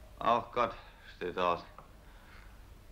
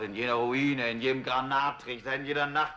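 rising anguished outcry; 6 semitones higher than usual. Acted. Accusing God, rising to outcry.

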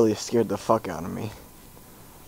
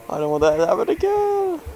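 scared whine; 11 semitones higher than usual. Real, not acted. Frightened after scare.

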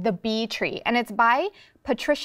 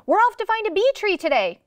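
high-pitched, eager read-aloud shout; storybook shout; 10 semitones higher, 4 dB louder than usual. A narrator's reading. Grandpa running by the neighbor with a carriage, shouting out. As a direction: call it out gleefully in passing. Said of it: "Grandpa shouted".

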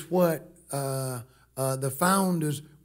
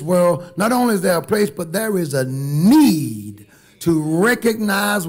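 loud, raised sermon voice; full-force preaching; 4 semitones higher, 6 dB louder than usual. Acted. Preacher urging church to bow to God, full-force preaching. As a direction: drive it home to the congregation.

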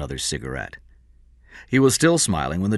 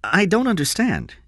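high-pitched bewilderment; puzzled; 13 semitones higher than usual. A narrator's reading. Lieutenant stares at King who refuses to help, puzzled. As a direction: pitch it up, baffled. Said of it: "Burns stared at him, puzzled".

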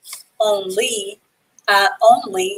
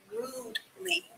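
whispered model word; 14 semitones higher, 17 dB quieter than usual. Shown as a demonstration. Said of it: "The E is silent when you pronounce it".

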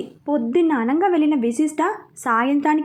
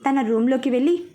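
usual speaking voice

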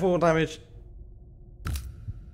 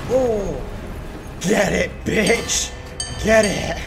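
loud triumphant yelling; excited shout; 3 semitones higher, 6 dB louder, much slower than usual. Real, not acted. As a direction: yell it out in triumph.

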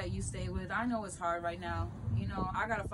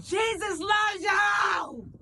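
very high, drawn-out yell; 11 semitones higher, 9 dB louder, much slower than usual. Retold or imitated. Answering the lady who threw food, a long loud yell. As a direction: yell it at full force, stretching each word. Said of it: "I yelled at her".

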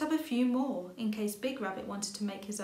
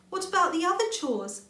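high, loud anxious rabbit; pleading for Squirrel; worried little rabbit; 8 semitones higher, 7 dB louder than usual. A narrator's reading. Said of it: "said Little Rabbit".

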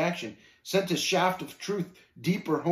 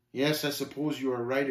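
usual speaking voice

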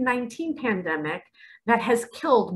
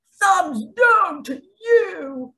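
high, loud, slow whiny sneer; hammed-up thumbs-down taunt; mocking commenter; 11 semitones higher, 6 dB louder, much slower than usual. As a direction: jeer it like a sulky troll. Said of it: "some of you are gonna be foaming at the mouth".

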